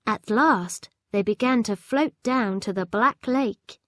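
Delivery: ordinary delivery, nothing marked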